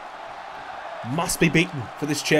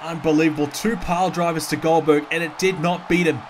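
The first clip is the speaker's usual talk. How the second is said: usual speaking voice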